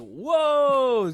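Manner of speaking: loud, high drawn-out exclamation; big exaggerated yelp of disbelief; mock shock. Prankster reacting to scam lady's cursing, loud mock shock.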